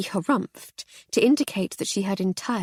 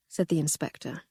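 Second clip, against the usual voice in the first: ordinary delivery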